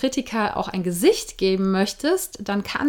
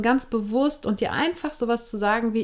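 ordinary talk, nothing marked

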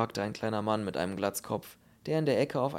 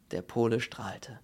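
ordinary delivery

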